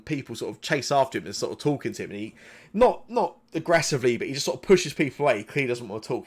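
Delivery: normal talking voice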